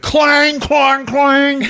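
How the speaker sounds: high, loud metallic clangs; shouted clang noises; imitated crashing clangs. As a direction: crash like falling armor. Imitates falling armor.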